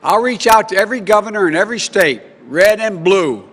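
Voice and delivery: loud, rousing campaign rally speech. Acted.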